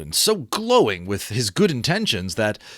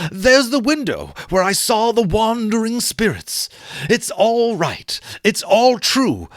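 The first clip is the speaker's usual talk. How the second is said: higher, louder breathless wonder; excited Scrooge; 9 semitones higher, 4 dB louder than usual. Acted. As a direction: point round the room in delight. Scrooge voiced in story reading.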